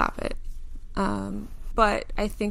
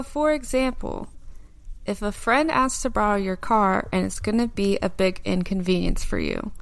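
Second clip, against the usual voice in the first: ordinary delivery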